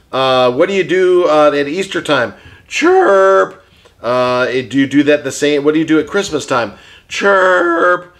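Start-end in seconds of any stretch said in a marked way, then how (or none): 2.7-3.6 parakeet chatter. Pretend. Puppet bird answers question. 7.1-8.2 parakeet chatter. Pretend. Puppet bird answers question.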